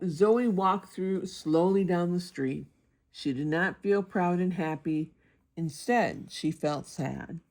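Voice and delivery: usual speaking voice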